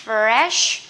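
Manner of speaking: Fresh loud and slow, long sh at the end; pouted SH. Shown as a demonstration. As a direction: push the lips out for the sh in "Fresh". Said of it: "S H mình chưa mỏ nè". (Fresh đọc chậm, chu mỏ âm sh)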